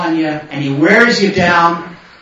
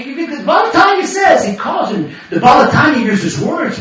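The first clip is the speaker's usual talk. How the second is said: ordinary delivery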